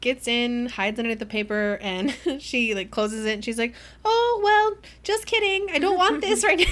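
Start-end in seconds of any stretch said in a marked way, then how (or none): 4.0-6.7 high, loud and airy, laughing at the end; mock breezy dismissal. Winter hiding Cress in the crate, in a high airy fake-casual voice. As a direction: toss it off with fake nonchalance. Winter pretending to change mind.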